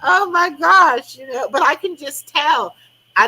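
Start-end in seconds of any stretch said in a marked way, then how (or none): 0.0-1.2 slow, high exasperated groan of oh my gosh. Retold or imitated. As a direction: drag it out in frustration. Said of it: "I'm like".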